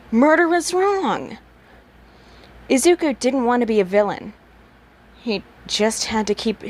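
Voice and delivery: usual speaking voice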